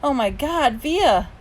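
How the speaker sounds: high, thrilled exclamation; excited squeal. A narrator's reading. Mom greeting her daughter backstage, excited. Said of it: "Mom was screaming with excitement".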